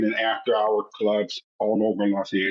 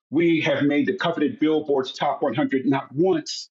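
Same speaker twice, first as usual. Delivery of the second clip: ordinary talk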